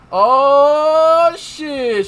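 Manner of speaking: loud sudden exclamation; surprised. Real, not acted. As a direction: caught off guard, blurting out.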